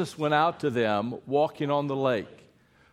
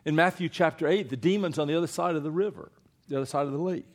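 ordinary talk, nothing marked

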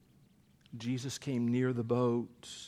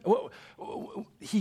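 amazed; 6 semitones higher than usual. Acted. Amazed exclamation.

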